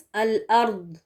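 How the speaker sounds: الارض closing on a thick, heavy d; careful recap of الارض with emphatic ض; heavy Daad. Shown as a demonstration. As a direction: say الارض with Daad, neither z nor light d. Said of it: "کیا ہوئے الفاظ؟".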